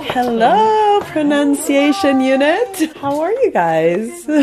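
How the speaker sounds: high, bright hello, drawn out slowly; cheery sing-song greeting. Real, not acted. Host dropping by the office next door greets the linguists, cheery and high. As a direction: call out hello like you're bursting in on friends.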